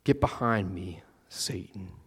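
Satan dropped to a whisper; hushed, intense rebuke. Retold or imitated. Jesus sternly rebuking his friend Peter, whispered. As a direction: lean in and hiss the name low. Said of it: "Kinda escalates it a little bit".

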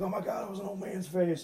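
high, mock-panicked retelling; freaked out. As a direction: raise the pitch like you just saw a face in the photo. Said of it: "She freaks the fuck out".

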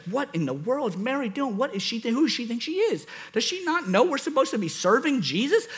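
high, swooping huffy complaint; mock indignant. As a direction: play an exasperated sister, pitch climbing as you go. Acts indignant Martha about her sister.

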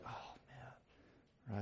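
very soft, low murmur of sadness; hushed, teary-eyed reaction; little weepy sigh. Acted. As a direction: let it out barely voiced, quietly moved. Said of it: "It's like this little".

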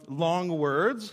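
deadpan slow delivery of long words; drawn out. Shown as a demonstration. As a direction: stretch long words very slowly. Joke about fear of words.

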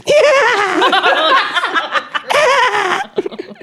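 very high hammed-up laugh; high French laugh. Shown as a demonstration. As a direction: show off a shrill silly laugh. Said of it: "They laugh like this".

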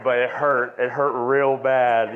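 half-reenacted tearful cry; retold crying. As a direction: relive the moment it hit you, choked up. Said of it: "it was like I was crying".

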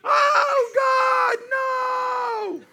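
high, loud anguished shout; panicked scream. Retold or imitated. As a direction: scream it like a kid in trouble. Son screaming heard from downstairs.

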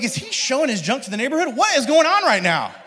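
high-pitched, loud disbelief; incredulous, escalating outburst; shocked dad. Retold or imitated. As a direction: retell it as an appalled parent. Acts out his own shocked reaction.

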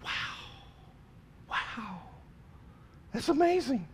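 high, breathy-free gasp of wonder; mock the crowd's awe, way up high; a wondering crowd's imagined response, pitched high; imagined amazed crowd. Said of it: "their response would have been".

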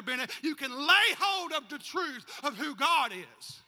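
loud, high rousing preaching; preaching at full force. Acted. Preacher calling the church to testify, loud and high. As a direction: lift the church to its feet.

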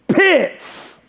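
sudden high-pitched call across the store; hammed-up holler of a surname; mock yell. Someone spotting the pastor getting coffee, mock yell. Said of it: "somebody yell out".

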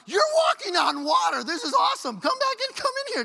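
loud, high-pitched thrilled yell; excited shouting. Acted. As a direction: shout in amazement, voice shooting up. Acting own thrilled reaction to Jesus.

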